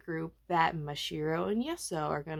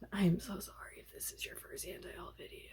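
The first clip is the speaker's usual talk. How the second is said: fast whispered apology; hushed quick confession; whispering; 11 dB quieter, faster than usual. Real, not acted. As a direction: whisper the aside to the viewer.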